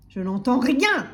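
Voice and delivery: loud, forceful roar; roaring butcher. A narrator's reading. Butcher dismissing timid customer, roaring. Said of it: "rugit le boucher".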